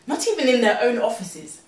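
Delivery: usual speaking voice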